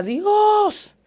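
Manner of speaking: high-pitched, drawn-out cry to God; imitated desperate wail, voice leaping up; retold scream. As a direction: cry out to heaven, acting it out. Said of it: "yo empecé a gritar".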